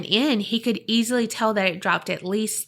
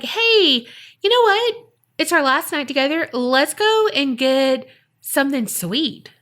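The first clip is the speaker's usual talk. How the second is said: high, upbeat, put-on cheer; trying to be brave; 6 semitones higher, 3 dB louder than usual. Shaken dad inviting his kids out, trying to sound calm. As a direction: pitch it up louder, acting casual for the kids. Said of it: "he was trying to be brave".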